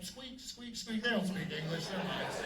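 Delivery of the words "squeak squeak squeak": soft, squeaky little mouse; a tiny, soft mouse voice; mouse squeak. Retold or imitated. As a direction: squeak it out small and timid. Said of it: "the mouse is like".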